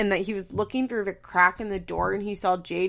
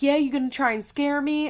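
retold taunt; 7 semitones higher, 4 dB louder than usual. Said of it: "throwing shit at Sean. He's like".

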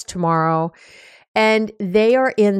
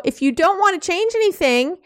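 higher, fast mock exasperated brush-off; a mild mock irritated dismissal; fed-up snap; 7 semitones higher, faster than usual. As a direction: rattle it off fast, done with them. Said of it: "you say things like".

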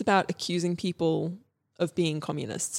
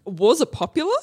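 surprised; 9 semitones higher, 7 dB louder, faster than usual. Real, not acted. Mishears, reacts in disbelief.

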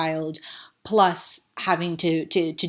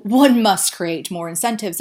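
louder, lightly posh upper-class tone; 3 semitones higher, 8 dB louder than usual. Retold or imitated. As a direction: play a mildly posh official. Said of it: "like, in a very posh voice".

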